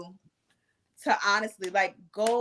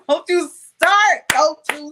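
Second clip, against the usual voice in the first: laughing, higher and louder, teasing warning; 6 semitones higher, 7 dB louder, slower than usual. Real, not acted. Host laughing as she playfully warns her guest. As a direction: tease him through a laugh.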